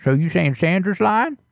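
put-on creaky old neighbor, fussy and nosy; old man voice. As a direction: play a suspicious grumpy grandpa. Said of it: "anytime I use that old voice".

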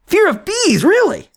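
high incredulous squeak; surprised. Real, not acted. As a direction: sound truly amazed. Incredulous at friend's ranking.

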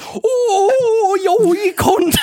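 very high, loud Scottish wail; hammed-up Scottish yelling; loud Scottish accent. Mimicking a friend's running gag, loud Scottish accent. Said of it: "too loud of a Scottish accent".

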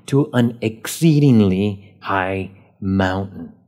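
slow down and lean on "exceedingly"; stress on exceedingly. Shown as a demonstration. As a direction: give "exceedingly" slow, deliberate weight. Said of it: "I kind of made emphasis on exceedingly".

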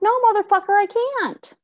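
high, indignant outburst; indignant retort. Retold or imitated. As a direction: snap back in outraged disbelief. Retold angry retort to staff.

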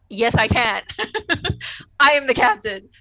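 loud, laughing assertion of rank; mock defiance. Host as Picard overruling Remick, mock defiance. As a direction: declare it hammed-up, breaking into laughs.

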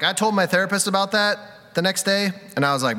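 normal talking voice

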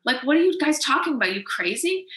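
mock-scoff at a ridiculous idea; incredulous outburst. To the book agents on the phone, an incredulous retold scoff. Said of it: "I was so deflated ... I was like".

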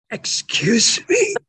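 high, loud, slow incredulous retort; shocked excuse me. Retold or imitated. Man retorting to God in disbelief, high and loud. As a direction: recreate the shock of being contradicted.

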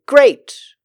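high, loud mock-cheerful great; hammed-up happy great. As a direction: chirp it like a fan. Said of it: "the people who ... say".

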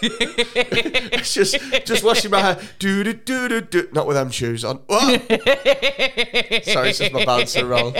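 laughing through a silly bouncer bit. Pretend. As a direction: play the neck roll as a bouncer, giggling. Acting out the bouncer skit.

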